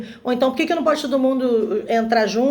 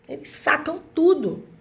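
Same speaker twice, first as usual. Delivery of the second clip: ordinary delivery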